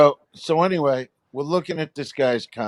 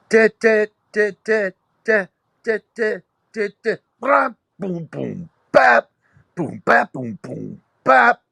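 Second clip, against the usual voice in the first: scatting a drum groove high and punchy; imitated drum beat; vocal drum beat; 7 semitones higher, 3 dB louder than usual. Shown as a demonstration. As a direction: sing out the drum fill. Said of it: "first songs I ever played on the drums".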